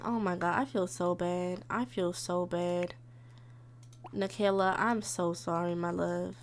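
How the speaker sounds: low, soft and remorseful; soft apology. Real, not acted. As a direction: drop down low and quiet to apologize. Apologizing to neglected child.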